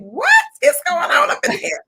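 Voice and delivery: loud, high incredulous outburst; laughing disbelief. Real, not acted. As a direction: crack up in amazed protest. Guest laughs at lavish introduction.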